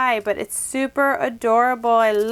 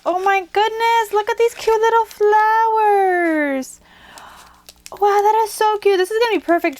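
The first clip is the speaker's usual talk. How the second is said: excited gush; 9 semitones higher, 4 dB louder than usual. Real, not acted. Gushing over crafted flowers.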